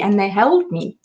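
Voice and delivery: ordinary talk, nothing marked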